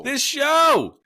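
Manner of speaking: loud, high, drawn-out yell; shout. Real, not acted. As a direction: belt the words out over your co-host. Echoing co-host's outro line.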